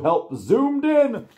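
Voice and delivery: high, slow rescue call; excited announcement. Acted. Storyteller cheering the rescue zooming in.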